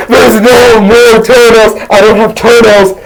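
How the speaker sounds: high acted insistence; exasperated denial. Host assures homie about toenails, mock exasperation.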